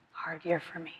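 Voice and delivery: near whisper echo. Real, not acted. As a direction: let it fade almost to nothing. Near-silent echo of the line before.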